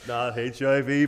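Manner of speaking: ordinary delivery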